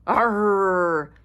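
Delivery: loud, sustained growling roar; big storybook lion's roar. A narrator's reading. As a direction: roar like a lion. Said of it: "roared the lion".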